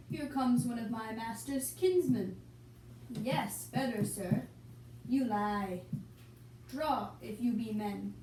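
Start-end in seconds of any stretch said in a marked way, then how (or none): none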